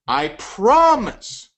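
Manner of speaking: slow, high "promise" drawn out; stressed promise. Shown as a demonstration. Sales coach drawing out the word to his audience, slow stress. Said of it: "When I say the word".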